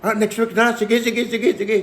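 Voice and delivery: high, stammering excited wife. Retold or imitated. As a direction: squeal it like she could jump for joy. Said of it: "Sabi niya". (tuwang-tuwang asawa, mataas at nauutal)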